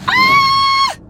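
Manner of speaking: sudden high shriek of alarm; startled shriek. Real, not acted. Driver alone in car, yelping in alarm. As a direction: yelp at the car lurching. Scared shout while driving.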